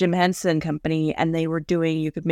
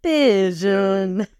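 Bert imitation; much slower than usual. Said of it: "Like Bert doing the eh, pigeon".

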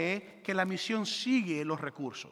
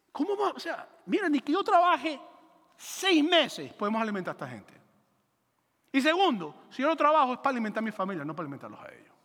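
incredulous protest; 3 semitones higher than usual. Acted. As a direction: balk at an impossible request, pitch jumping up. Felipe's indignant retort to Jesus, acted.

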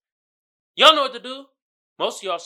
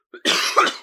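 hard dry cough; coughing fit; 5 dB louder than usual. Real, not acted. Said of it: "I'm coughing a lot".

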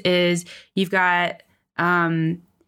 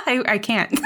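laughing while being tickled; giggling helplessly through the words; 5 semitones higher than usual. Real, not acted. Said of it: "I'm ticklish".

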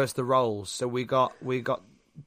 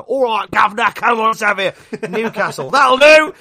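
loud, higher mock Cockney geezer; hammed-up working-class British accent, building louder; 6 semitones higher, 7 dB louder than usual. Mocking the wrestler's half research, cartoon working-class accent. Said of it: "I need to sound like I'm from a working class town".

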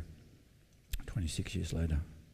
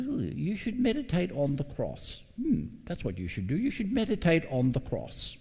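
much higher, thin squeaky voice of a shy man; high squeaky principal; 8 semitones higher than usual. Retold or imitated. Shy principal answering the student, high squeaky voice. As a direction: coo it way up high like a timid old teacher. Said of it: "spoke in a really high, squeaky voice".

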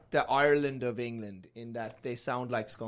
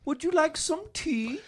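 high, mock British tea-lady voice; British accent; 11 semitones higher than usual. Comic sending up the English, high British-accented offer. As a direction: trill it in caricature British. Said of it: "also a British accent".

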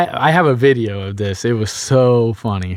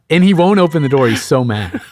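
ordinary talk

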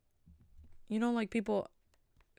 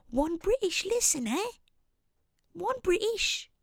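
very high, hammed-up British voice; cartoonish high-pitched British; mock British accent; 12 semitones higher, slower than usual. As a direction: squeal it in a put-on British accent. Playful voice announcing a British listener.

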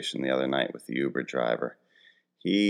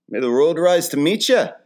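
high, loud mock-Irish with uptick; hammed-up Irish blessing, faintly Russian lift; Irish accent drifting Russian; 12 semitones higher, 6 dB louder than usual. Shown as a demonstration. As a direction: botch an Irish accent toward Russian at the end. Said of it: "That felt a little Russian".

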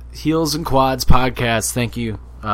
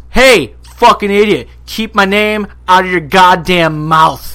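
imitated angry tirade, loud and slow; MJF; 7 semitones higher, 11 dB louder, slower than usual. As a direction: chew out a stranger, loudly, word by word. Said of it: "and he just goes".

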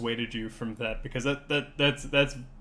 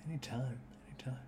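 whispering; 11 dB quieter than usual. Real, not acted. Softly reassuring a guest.

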